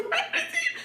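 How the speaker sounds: very high, softened squeal; mock scolding mom. Mother's question squealed again.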